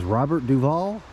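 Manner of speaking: high, rising query; questioning echo. Retold or imitated. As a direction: echo the name back as a puzzled question. Comedian asks the name back again.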